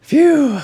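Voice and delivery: high, loud relieved exhale; relieved phew. Retold or imitated. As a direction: blow out the relief. Relief after leaving awkward bed.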